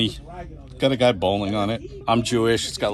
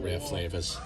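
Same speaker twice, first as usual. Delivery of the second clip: softer, offhand Boston accent, dropped R in "Rare Flavors"; 3 semitones lower, 7 dB quieter than usual. Real, not acted. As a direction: repeat "Rare Flavors" quietly in Boston speech, "Ray Flay-vuhz". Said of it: "That's my Boston accent".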